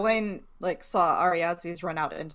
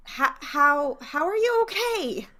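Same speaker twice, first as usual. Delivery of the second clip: high shaken question; 8 semitones higher, 4 dB louder than usual. Acted. Shaken child asks.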